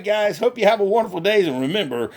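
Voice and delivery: normal talking voice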